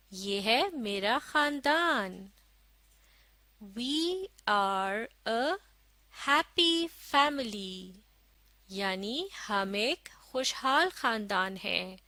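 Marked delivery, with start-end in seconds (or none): 3.6-7.9 very slow, word by word; slow model reading. Shown as a demonstration. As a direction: read it out slowly for learners to follow. Model reading for learners to follow. (धीरे-धीरे, एक-एक शब्द पढ़कर)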